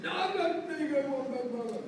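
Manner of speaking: mock wail of a homesick kid; wailing little boy. Crying child refusing to stop.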